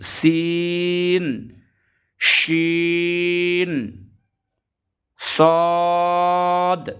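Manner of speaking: সিন drawn out long and level; three-count stretch. Shown as a demonstration. Teacher demonstrating long সিন. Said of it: "তিন আলিফ".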